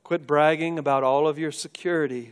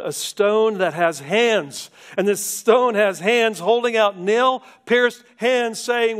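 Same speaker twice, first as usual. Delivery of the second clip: high, loud, pleading preaching; impassioned altar call; 8 semitones higher, 8 dB louder than usual. Acted. Preacher pleading with listeners to come to Jesus.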